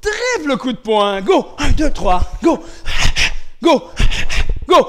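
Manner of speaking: loud, high karate count; punchy instructor's shout; shouted drill commands. Real, not acted. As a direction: call out each punch command with force.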